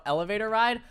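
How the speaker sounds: normal talking voice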